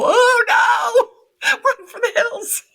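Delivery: high, loud laughing shout; a mock freaked-out parent hearing their child's beliefs; mock panicked shout. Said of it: "We don't go".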